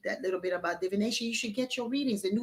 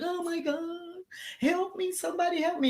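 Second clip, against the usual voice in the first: high desperate cry for help from a lion stuck in a pit; pleading trapped lion; 9 semitones higher than usual. Acted.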